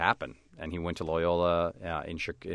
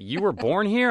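high, doubting consulate clerk; incredulous official; 14 semitones higher, 7 dB louder than usual. Retold or imitated. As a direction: ask it like you can't believe it. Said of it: "they're like".

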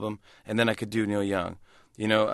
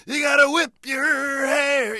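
high, loud, slow sung shout; high stretched rock wail; high-energy belt; 14 semitones higher, 5 dB louder, much slower than usual. Retold or imitated. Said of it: "jump in with that energy".

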